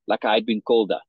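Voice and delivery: ordinary delivery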